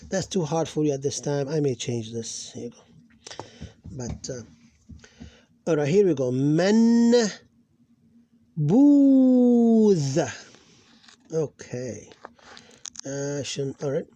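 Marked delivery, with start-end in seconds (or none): 6.3-10.2 "Man" with long held n, "booth" with long oo; loud, higher, sustained "Man, booth"; drawn-out syllables. Shown as a demonstration. Teacher demonstrating Arabic syllables, "Man, booth" held long. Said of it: "this extending letter".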